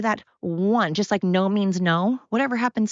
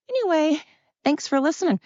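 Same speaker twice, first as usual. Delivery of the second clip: cheery sign-off; 9 semitones higher than usual. Real, not acted. Brightening to sign off.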